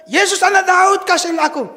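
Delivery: loud, high desperate cry. Acted. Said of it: "Dia teriak makin keras".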